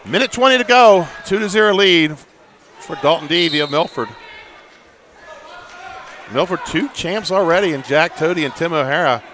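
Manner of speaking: high, projected clock call, easing down; live play-by-play. Real, not acted. Announcer gives time, lead and champs, live play-by-play. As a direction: punch out time and score, then relax.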